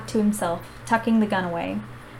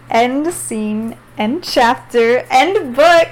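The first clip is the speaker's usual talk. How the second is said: triumphant finish; 3 semitones higher, 7 dB louder, much slower than usual. Real, not acted. Hosts finishing the book, triumphant announcement. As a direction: announce the very end with glee.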